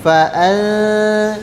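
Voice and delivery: "an-" hummed long with the tongue not touching; veiled n. Shown as a demonstration. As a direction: hold a blurred hum on "an-", tongue kept free. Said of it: "lidahnya tidak melekat pada makhraj". ("an-" didengungkan, lidah tidak melekat)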